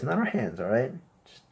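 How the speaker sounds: normal talking voice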